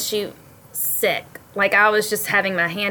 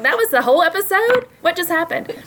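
stunned disbelief; 10 semitones higher than usual. Retold or imitated. Retelling their own shocked reaction.

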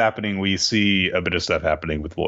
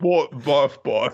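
mock barking; 7 semitones higher, slower than usual. Imitating the dog barking.

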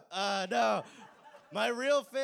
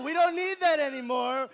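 exasperated scolding; 7 semitones higher, 8 dB louder than usual. Retold or imitated. Scolding the imagined grandchild.

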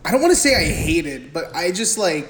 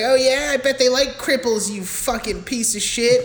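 mock sneering sister; 7 semitones higher than usual. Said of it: "she's like".

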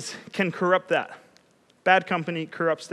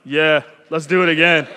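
low, groggy-cool reply; lower, casual mock bravado; dazed self quote; 5 semitones lower, 3 dB louder than usual. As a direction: answer low and unfazed, just come to. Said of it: "I'm like".

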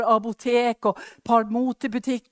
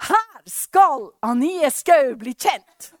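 proclaim; 4 semitones higher, 7 dB louder, much slower than usual. Retold or imitated. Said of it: "«Gå og rop".

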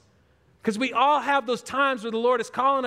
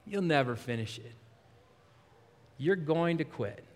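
discouraging inner voice; 8 semitones lower, 7 dB quieter than usual. Acted. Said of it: "that is my number one voice".

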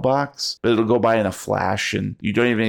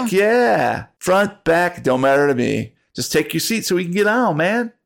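stoner bus driver voice; 8 semitones higher than usual. Acted.

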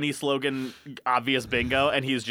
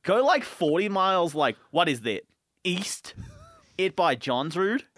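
fed-up Aussie giving directions; hammed-up Australian exasperation; put-on Australian accent; 2 semitones higher than usual. Telling the guy where to find grizzlies, put-on Australian accent. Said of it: "in an Australian accent".

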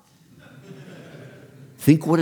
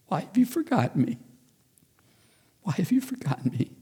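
higher, soft voice of the wife crying out; wife pleading; 11 semitones higher, 3 dB quieter than usual. Retold or imitated. Wife in hospital crying out to God, high and soft.

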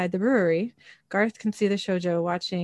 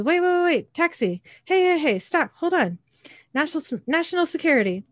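high-pitched, hurried hailing, acted in recap; acted urgent calling; 8 semitones higher than usual. Sam stops a couple's taxi, acted urgent calling. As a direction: call out to stop someone, pitch raised.

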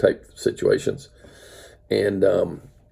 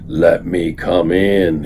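lower, slow, louder wolf voice; wolf calling the first little pig, lower and slow; big bad wolf; 4 semitones lower, 4 dB louder, slower than usual. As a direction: growl it slowly at the pig's door. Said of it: "he roared".

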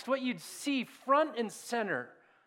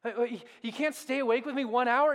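frustrated Jesus; 3 semitones higher than usual. Retold or imitated. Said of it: "I mean, he's like".

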